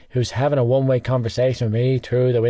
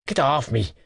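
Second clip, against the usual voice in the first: strangled. Acted. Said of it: "he strangled out".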